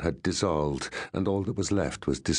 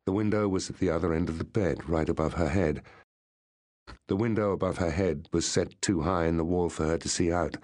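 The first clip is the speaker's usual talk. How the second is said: ordinary delivery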